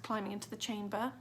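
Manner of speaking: ordinary delivery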